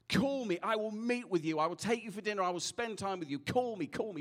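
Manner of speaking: high, insistent retold plea, easing slightly; urgent pleading. Preacher leaving a crying stranger on the train, pleading. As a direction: plead earnestly with someone you must leave.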